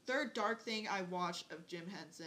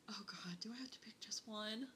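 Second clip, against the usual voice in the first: whispered, unvoiced groan of indecision; hushed, overwhelmed whisper; anguished whisper; 9 dB quieter than usual. Real, not acted. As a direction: whisper it as if the choice is too hard. Agonizes over picking one favorite.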